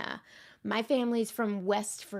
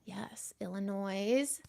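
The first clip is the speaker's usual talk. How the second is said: ordinary delivery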